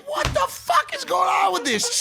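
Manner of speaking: baffled. Retold or imitated. Boyfriend retelling his baffled reaction, high drawn-out mock yell. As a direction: drag it out, stunned. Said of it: "I'm like".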